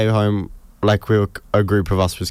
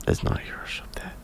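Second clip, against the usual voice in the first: whispering; 11 dB quieter, faster than usual. Real, not acted. Whispered aside.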